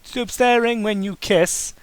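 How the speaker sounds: usual speaking voice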